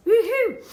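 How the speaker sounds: loud, high cheering woohoo; excited woohoo. Real, not acted. Host cheering to her live viewers, loud whoop.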